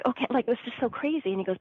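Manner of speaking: usual speaking voice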